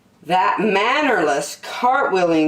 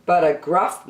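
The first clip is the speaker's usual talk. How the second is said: ordinary delivery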